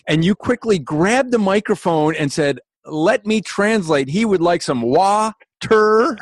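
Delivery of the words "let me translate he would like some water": slow and overly deliberate, spelling it out; mock-translate it slowly for the crowd; mock translation. Retelling how he translated for the Midwestern emcee, slow and deliberate.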